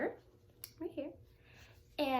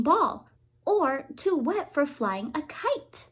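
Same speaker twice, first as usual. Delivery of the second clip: louder, lively storybook voice; reading to children; 2 semitones higher, 12 dB louder than usual. A narrator's reading. A reader reads Curious George aloud to children. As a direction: read it out bright for kids.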